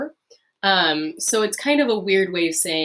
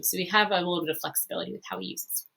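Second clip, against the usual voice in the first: usual speaking voice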